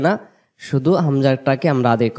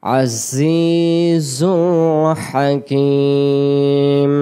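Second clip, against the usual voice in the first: slow, drawn-out chanted tajweed recitation; Quran recitation; 2 semitones higher, much slower than usual. Acted. Teacher reciting the whole verse for students, slow chanted recitation. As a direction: chant each word long and melodic.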